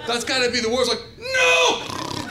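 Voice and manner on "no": very high, loud shout of refusal; shrieked, panicked protest; horrified scream. Acted. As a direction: scream it out in mock horror. Horrified patient sees guinea pig surgeon.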